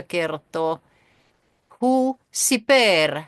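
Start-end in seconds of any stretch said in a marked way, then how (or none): 1.8-3.2 "Hu siper" drawn out slowly, pitched up on "Hu"; slow, higher demo. Shown as a demonstration. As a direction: spell "Hu siper" out slowly, lifting "Hu" high. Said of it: "hän kertoi".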